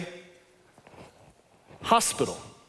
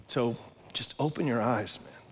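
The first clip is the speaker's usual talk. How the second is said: soft urging; 8 semitones lower, 8 dB quieter than usual. Real, not acted. Pastor softly urging congregation.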